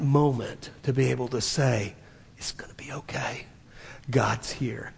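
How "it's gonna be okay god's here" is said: soft whisper, almost no voice; a hushed promise that all is well; whispered comfort. Retold or imitated. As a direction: breathe the words gently to soothe. Retelling words of comfort.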